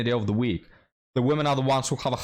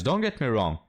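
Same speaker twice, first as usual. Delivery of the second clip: ordinary talk, nothing marked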